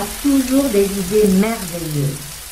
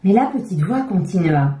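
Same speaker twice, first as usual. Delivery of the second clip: usual speaking voice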